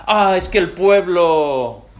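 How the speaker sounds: whiny excuse. Acted. Saúl excuses himself.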